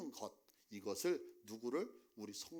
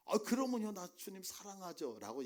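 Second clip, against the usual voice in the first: eager boast; 7 semitones higher, 5 dB louder than usual. Retold or imitated. Peter boasting his love hypothetically.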